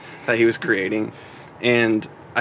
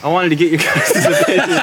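higher, louder repeat breaking into laughter; laughing; 5 semitones higher, 5 dB louder than usual. Real, not acted. Host asking the guys' opinion on new music, real laughter breaking in. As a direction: try to get it out, then crack up.

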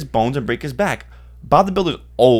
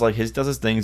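normal talking voice

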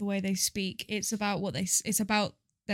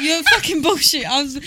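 loud, high, through laughter; real laughing outburst; 5 semitones higher, 9 dB louder than usual. Real, not acted. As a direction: burst out laughing while protesting. Cracking up after failed acting scene.

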